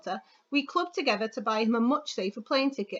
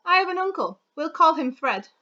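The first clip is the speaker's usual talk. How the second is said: persuasive reading; 6 semitones higher, 5 dB louder than usual. Acted. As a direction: read it like a rousing persuasive speech. Reads model article aloud.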